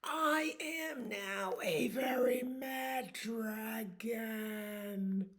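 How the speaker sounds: slow, whispered mad dragon; mock-whispered mad dragon. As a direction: say it slow and hushed, mock fury. Dragon declares anger.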